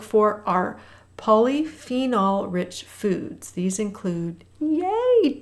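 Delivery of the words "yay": high, airy drawn-out squeal of delight; happy little cheer. Real, not acted. Cheering for dark chocolate on the list.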